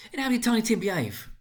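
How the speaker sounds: put-on a mother keen for news of her boy; mother asking. A narrator's reading. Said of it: "asked Missus Cratchit".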